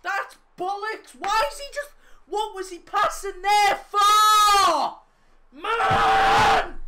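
real high, loud raging yells at a late goal; furious shouting. Real, not acted. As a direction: scream in disbelief and fury. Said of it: "I'm so fucking angry".

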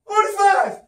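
high straining triumphant yell; final rep shout. Real, not acted. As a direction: roar out the last rep.

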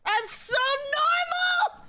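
drawn-out, very high squealed shout; overexcited scream. Real, not acted. Overwhelmed fan insisting on being normal, screaming. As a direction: squeal it slowly at full pitch, losing all composure.

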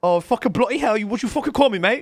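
high, loud British outrage; hammed-up offended British swearing; mock British accent. Offended by moggy milk offer, mock British accent.

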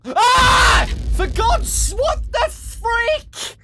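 screams, then high panicked words; shriek, then a slow high panicked question; real panic after a jump scare; terrified scream. Real, not acted. Jump scare mid-game.

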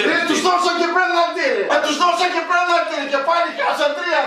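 high, worked-up ranting; excited outburst. Real, not acted. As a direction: rant it, still disbelieving the score. Fan ranting about the penalty.

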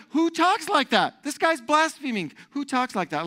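high-pitched indignant disbelief; hammed-up outrage, voice way up; scandalized objectors. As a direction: protest like offended onlookers. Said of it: "But they say, like".

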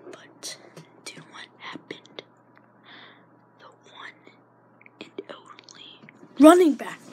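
breathy hushed suspense; dramatic whisper. Real, not acted. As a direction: build the reveal in a whisper.

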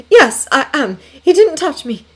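high-pitched, insistent reassurance; quick reply. A narrator's reading. Daughter reassures her mother about the intruder, quick reply. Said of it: "she said quickly".

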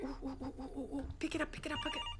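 very soft urging; quiet. Real, not acted. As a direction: murmur it tensely. Softly urging the game.

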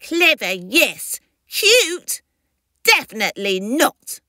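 indignant little creature, pitch jumping high; angry baby. Acted. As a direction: object crossly in a baby character voice. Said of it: "said the baby angrily".

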